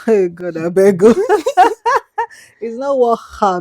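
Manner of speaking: ordinary delivery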